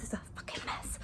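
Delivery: hushed aside under the breath; whisper. Real, not acted. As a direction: whisper it so nobody hears. Embarrassed aside about messy house.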